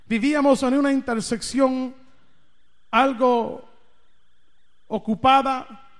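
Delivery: ordinary talk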